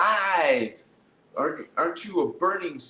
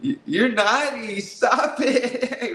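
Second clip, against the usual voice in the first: giggling, higher, teasing; laughing; 7 semitones higher, 3 dB louder, slower than usual. Real, not acted. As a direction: laugh through a playful scold. Laughing tease after joke.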